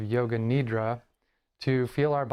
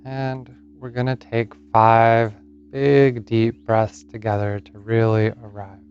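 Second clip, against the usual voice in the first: guided meditation; 10 dB louder than usual. Acted. Yoga teacher settles listeners into Yoga Nidra, guided meditation. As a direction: lead them gently into rest, phrase by unhurried phrase.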